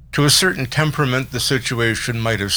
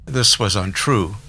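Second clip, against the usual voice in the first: plain reading voice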